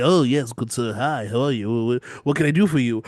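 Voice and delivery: low-ish, hunched creepy servant voice; lightly voiced hunchback, fawning; hunched Quasimodo. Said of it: "fucking Quasimodo over here, just with a hunch".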